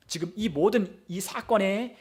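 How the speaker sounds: ordinary talk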